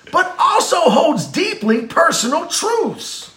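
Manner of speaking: slow, high-pitched hammed-up dramatic oratory; Samuel L. Jackson. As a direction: stretch every word like a fiery actor's monologue. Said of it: "the voice of one of my favorite actors".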